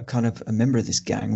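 normal talking voice

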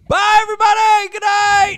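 loud, high cheerful sign-off; shouting goodbye. Real, not acted. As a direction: call out goodbye to everyone. Signing off the live show.

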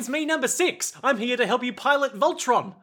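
high-pitched New Zealand comic voice; Rhys Darby. Acted. Number six popping up to Bruno, Rhys Darby impression. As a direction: chirp it up high, calling for attention. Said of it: "like, Rhys Darby impression".

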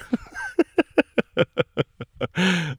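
long laugh, falling away; real laugh dying down; high laugh. Real, not acted. Laughing at her own story.

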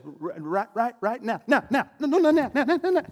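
a laughing, breathless race to say "now"; frantic chasing of now. Shown as a demonstration. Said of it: "The moment you say".

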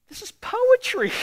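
high, thrilled exclamation; delighted. Real, not acted. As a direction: sound amazed. Marvels at the text.

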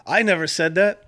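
loud sportscaster hand-off, lightly voiced; a hint of a TV sportscaster; Warner Wolf impression. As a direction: toss to the tape like a hammy newsman. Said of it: "I felt like Warner Wolf".

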